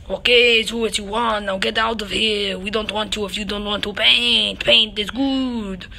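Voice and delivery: lightly put-on French accent, shooing someone off; a hint of a huffy French painter. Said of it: "in a French art comic book".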